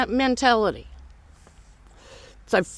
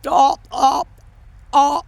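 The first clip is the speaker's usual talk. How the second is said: mock fluster; 4 dB louder than usual. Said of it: "Nah, I'm sorry".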